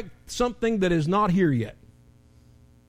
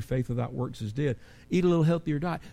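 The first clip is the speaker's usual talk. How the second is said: ordinary talk, nothing marked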